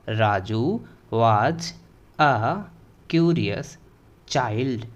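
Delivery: put-on "राजू was a curious child", slow, word by word; pronunciation demo. Shown as a demonstration. Teacher modelling the English sentence for students, slow pronunciation demo. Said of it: "कैसे प्रोनाउंसिएट कर रहे हैं".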